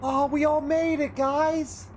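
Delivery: excited. Real, not acted. Cheering as everyone survives.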